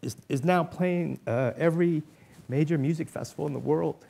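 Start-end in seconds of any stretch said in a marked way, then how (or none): none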